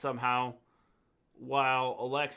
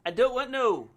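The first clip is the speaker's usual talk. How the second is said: high, loud mock-accent "I don't want no"; botched accent imitation; 9 semitones higher, 5 dB louder than usual. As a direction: mimic her accent loudly and badly. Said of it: "You're not saying it right".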